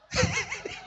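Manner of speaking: high breathy laugh; preacher laughing at the jinn joke. Real, not acted. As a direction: burst out laughing.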